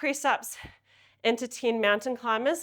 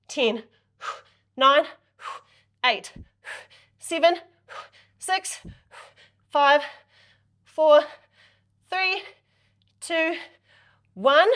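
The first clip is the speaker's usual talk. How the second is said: loud coach count; 4 semitones higher, 4 dB louder, slower than usual. Shown as a demonstration. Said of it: "coach counting down reps".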